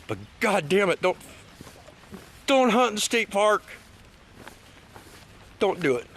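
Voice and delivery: a heartfelt scolding of poachers, climbing high; heated plea. Real, not acted. Vlogger scolding poachers in the state park, heated pleading.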